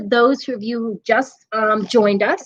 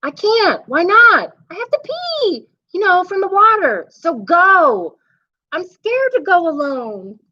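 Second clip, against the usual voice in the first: put-on pleading little boy at bedtime, voice up high; a kid bargaining to stay awake; high child voice; 9 semitones higher than usual. Boy begging the monster for one more thing, high and pleading.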